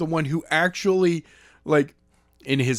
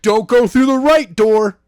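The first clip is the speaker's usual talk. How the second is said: loud, higher hammed-up hint; Daniels hinting loudly; 7 semitones higher, 9 dB louder than usual. Daniels urging Skylar at the doors, loud and hammy. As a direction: Daniels practically shouting the hint. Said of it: "Daniels is like".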